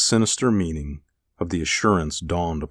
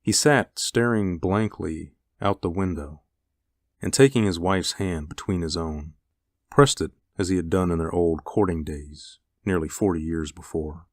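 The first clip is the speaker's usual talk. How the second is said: ordinary delivery, nothing marked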